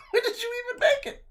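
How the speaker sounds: laughing high and fast. Real, not acted. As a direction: ask it through laughter. Laughing at friend.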